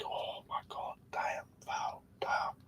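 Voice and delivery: barely voiced, overwhelmed by the scene; stunned whisper. Real, not acted. Recapping the show to viewers, a stunned whisper. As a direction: breathe it out in a hushed, shaken whisper.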